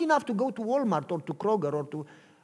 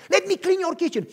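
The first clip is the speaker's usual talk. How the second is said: higher, louder eager begging; pushy eager offer; eager plea; 11 semitones higher, 7 dB louder than usual. Acted. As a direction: beg to be allowed to work.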